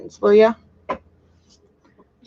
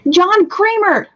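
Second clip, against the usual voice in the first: high, loud, drawn-out exclamation; excited recognition; 9 semitones higher, 5 dB louder, slower than usual. Real, not acted. Streamer recognises the forgotten film name, excited exclamation. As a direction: cry out the name you were hunting for.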